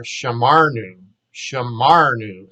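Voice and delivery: "shamarnu" with a loud middle syllable; accent on MA. Shown as a demonstration. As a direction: say "shamarnu" as sha-MAR-noo. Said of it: "Accent is on the ma".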